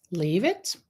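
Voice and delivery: ordinary talk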